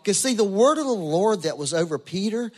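high-pitched, emphatic sermon delivery; impassioned preaching. Real, not acted. Preaches with rising force.

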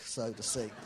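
normal talking voice

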